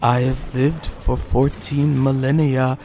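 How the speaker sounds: slow, halting mock villain; evil villain impression. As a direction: imitate a movie villain, lingering on every word. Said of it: "he's like".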